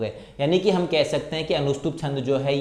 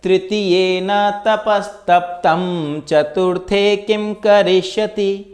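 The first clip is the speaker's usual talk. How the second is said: slow, high, loud chanted verse; shloka recitation; 6 semitones higher, 5 dB louder, much slower than usual. Shown as a demonstration. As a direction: chant it out slowly with feeling. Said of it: "इसके बारे में और भी कहा जाता है". (धीमा, ऊँचा श्लोक पाठ)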